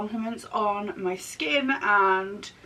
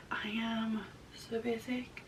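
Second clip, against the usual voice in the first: whispering; 11 dB quieter than usual. Real, not acted. Confessing aside about basic makeup.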